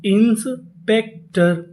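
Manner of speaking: Inspector drawn out very slowly; slow model reading; very slow word. Shown as a demonstration. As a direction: stretch it for learners. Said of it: "तो पूरी meaning".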